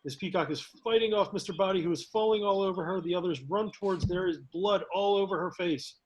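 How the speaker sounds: usual speaking voice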